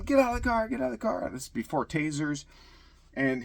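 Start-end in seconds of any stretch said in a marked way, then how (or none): 0.0-1.3 high-pitched yelled order, not full volume; mock yell, pitched way up; retold yell. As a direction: re-enact a repeated shouted command. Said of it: "I'm yelling at him".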